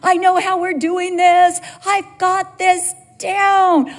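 high, drawn-out overconfident proclamation; mock-triumphant cry to the Lord; cocky declaration. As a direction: build to a loud, stretched finish, sure you know it all. Acts out overconfident believer to God.

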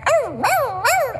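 high yipping coyote howls; coyote howl imitation. Preacher imitating coyotes to the church, high yips. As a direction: yip like coyotes working themselves up. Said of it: "they start like".

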